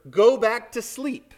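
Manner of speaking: normal talking voice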